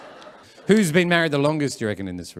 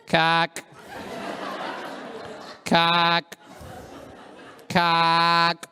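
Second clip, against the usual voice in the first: slightly raised, held name call; lightly voiced barista call, evenly held; calling an order; 3 semitones higher, 5 dB quieter, much slower than usual. Barista calling a customer's coffee, patient call-out.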